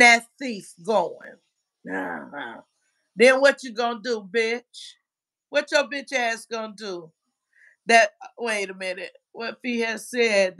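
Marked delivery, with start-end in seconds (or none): none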